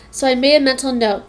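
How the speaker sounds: ordinary delivery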